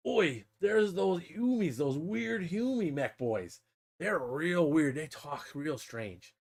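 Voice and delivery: slow, drawn-out orc talk; imitated orc mech, dragging the words. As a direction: draw it out like a puzzled orc. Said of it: "the mech guy's like".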